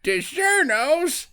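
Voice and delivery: put-on high, slow old-lady voice. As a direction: play a disgusted old lady, drawn out and high. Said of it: "She's like".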